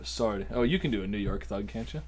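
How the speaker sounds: ordinary talk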